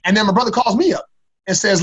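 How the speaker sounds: normal talking voice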